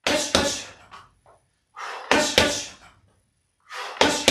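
sharp whispered hush with each punch; hush exhales. Shown as a demonstration. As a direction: breathe out hard on every hush. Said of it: "Letting those hush, hush punches snap out".

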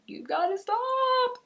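high, loud, drawn-out plea; exasperated mock yell. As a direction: cry it out slowly at a chattering pupil, sky-high. Exasperated teacher to nonstop talker.

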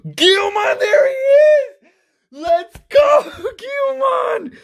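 genuinely losing it over a favorite card; cheering a favorite Digimon pulled from the pack, shouting; excited shouting. Real, not acted. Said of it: "by my excitement".

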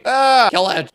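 high loud panicked scream; panicked shriek. Real, not acted. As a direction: scream at the bunny. Bunny appears.

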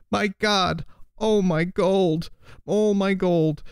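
high, wailing miser's lament; a miser crying for his gold; wild cry. A narrator's reading. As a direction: wail over the lost gold. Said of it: "cried the miser wildly".

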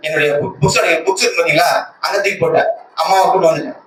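ordinary delivery

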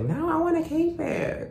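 put-on high whiny childish voice; stubborn eye voice. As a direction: voice the eye as a bratty kid. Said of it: "It's always like".

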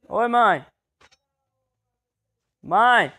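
loud shout across the house; calling out. Real, not acted. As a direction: yell for mom from far away. Calling mother from afar.

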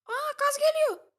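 high, loud, slow shout; excited high gamer shout. Real, not acted. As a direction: yell it out high and stretched. Shouting in game.

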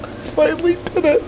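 very high, half laughing, half crying; elated tearful. Real, not acted. Gamer celebrating two-year challenge win, tearful elation. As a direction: let the joy spill over, voice cracking.

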